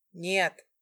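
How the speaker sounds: short loud roar of refusal; forceful growled shout. A narrator's reading. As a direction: roar it out, without raising pitch. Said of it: "ещё один рык".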